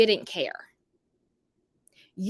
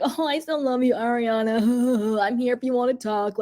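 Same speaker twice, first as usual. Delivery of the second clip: mock a smug, smirking declaration of love. Imitating Tom telling Ariana he still loves her, mock smirking. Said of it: "how he kept smirking when he's like".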